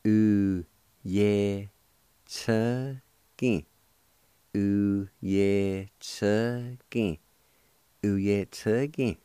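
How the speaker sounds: Uyetsugi drawn out very slowly, syllable by syllable; slow careful pronunciation. Shown as a demonstration. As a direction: stretch Uyetsugi out slowly for learners. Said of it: "is pronounced like this".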